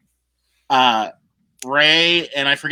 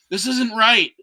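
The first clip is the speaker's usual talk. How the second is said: higher, breath-short protest ending in a gasp; retold panic; 6 semitones higher than usual. Groom mimicking his best friend before the wedding, mock panic. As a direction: imitate a friend freaking out. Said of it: "He's, like, freaking out".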